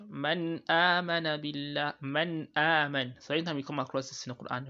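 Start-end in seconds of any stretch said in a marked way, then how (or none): 0.1-3.1 slow recited "Man amana billah", clear n straight into long "a"; nun without emphasis. Shown as a demonstration. Teacher showing the right way, unemphasised n in "Man amana". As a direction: lengthen the "a" of "amana", not the n of "Man". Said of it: "You should recite like".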